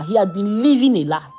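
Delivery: ordinary delivery, nothing marked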